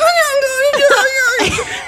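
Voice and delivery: high, loud nonstop mock moaning yelps; mock climax screaming. Host imitates a nonstop climax, shrieking comically. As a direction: squeal wildly without a breath. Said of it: "they sound like they're coming the whole time".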